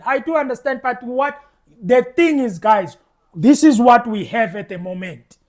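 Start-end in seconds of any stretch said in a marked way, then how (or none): none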